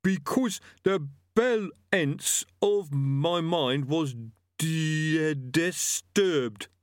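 slow, high, stumbling through each word; laboured reading. A narrator's reading. Barely literate killer reading out his defence, haltingly. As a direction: struggle through it and break the hard word. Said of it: "written word is an uphill struggle".

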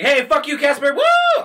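loud, high taunting shout; rowdy yelling. Acted. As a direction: jeer at him like a rowdy ghost. Voicing the rowdy uncle ghosts.

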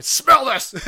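retold urgent yell, high and loud; excited shouting. Host retells running out to share a smell, excited shout. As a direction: shout it, drawing the words out.

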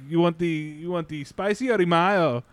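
imitated Mexican restaurant worker's accent; thick Mexican accent. Retelling a Mexican restaurant order, thick Mexican accent. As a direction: thicken the vowels like a Mexican counterman. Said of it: "the guy has a thick accent".

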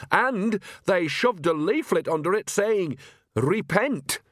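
put-on high, slow, indignant old woman; cackly old gossip; snapping old woman. A narrator's reading. Nanny Ogg speaks.

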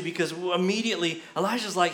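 usual speaking voice

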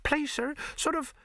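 very high, hesitant servant voice; timid maid. A narrator's reading. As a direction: begin shyly, searching for the sound. Timid maid asked to imitate noise.

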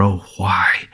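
Mary turning away, whispering her question within; inward sigh. A narrator's reading. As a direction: breathe it to yourself, barely voiced. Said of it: "echoing in, within her heart".

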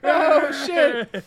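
high, surprised curse; startled. Real, not acted. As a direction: react in shock. Startled reaction.

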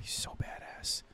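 hushed whisper of admiration. Real, not acted. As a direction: whisper it under your breath. Whispered aside.

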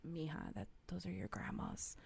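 a dad quietly letting his daughter know; lightly voiced hushed father. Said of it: "my dad was like".

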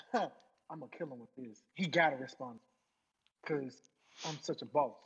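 a hint of a self-satisfied boss; mock cocky rapper. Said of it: "you really feel like".

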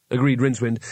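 ordinary delivery

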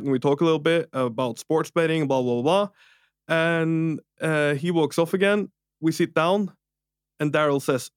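ordinary talk

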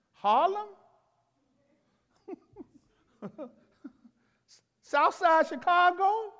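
high, loud incredulous cry of each place; emphatic exclaiming. Retold or imitated. Preacher voicing Nathanael's scorn, loud and high. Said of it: "he said with emphasis".

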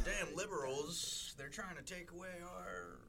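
slow, high, put-on ranting voice; mock anti-liberal ranter. Podcaster parodying a political podcast, mock outrage.

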